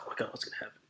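breathless whisper of suspense; tense whisper. Retold or imitated. Gripping the chair during Baby Driver, whispering in suspense. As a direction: whisper it like you're gripping your seat. On edge of seat watching.

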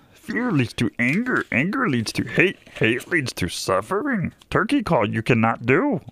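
a sleepy Jedi master handing down a lesson; host's tired Yoda impression on the radio. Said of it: "that's my no sleep Yoda".

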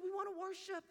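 put-on high-pitched children's voices; children's answer. Children answering what they'd like to do, high-pitched.

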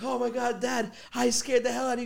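gleeful prankster. Retold or imitated. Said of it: "I'm like".

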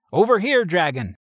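high, loud taunt to draw the dragon; yelling taunt. Acted. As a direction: bait the dragon with a yell. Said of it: "circling the dragon and yelling".

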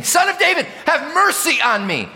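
very high, loud shout for mercy; screamed plea. Acted. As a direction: scream desperately to be heard over a crowd. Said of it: "He's screaming".

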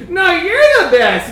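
put-on high, loud drunk slur of affection; drunken gushing. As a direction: gush it like a sloppy drunk. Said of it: "drunkenly talking to".

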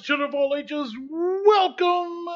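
booming showman intro, pitched high; ringmaster announcer. Acted. Opening a podcast like a ringmaster.

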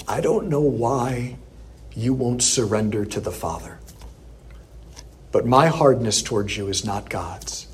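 a lightly voiced sobbing priest's tender prayer; sweetest voice. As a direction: say it gently through tears. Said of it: "in the sweetest voice".

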